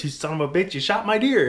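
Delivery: high and rising to a loud yell; fake-angry, really thrilled; mock outrage. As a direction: uncle hollering in joking disbelief. Uncle mock-outraged over huge buck.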